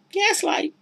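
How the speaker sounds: very high, breathy pronouncement; dramatic high word. Real, not acted. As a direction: say it high like a reveal. Naming the tactic dramatically.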